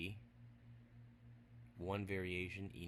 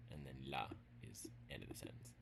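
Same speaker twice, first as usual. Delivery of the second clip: quick unvoiced whisper; hushed side note; whispered aside; 6 semitones lower, 7 dB quieter, faster than usual. Real, not acted. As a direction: drop to a fast whisper.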